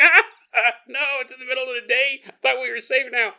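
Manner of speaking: high, yelping mock fright; hammed-up high alarm; mock panicked mother. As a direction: play a spooked mom, starting with loud yelps.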